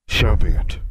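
loud, booming monster voice; riddling sphinx. Acted. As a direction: pose the riddle as a big beast. Sphinx accepts the challenge.

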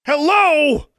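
loud, very high-pitched yell; exasperated shout. A narrator's reading. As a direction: yell to get their attention. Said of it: "Alexandra shouted".

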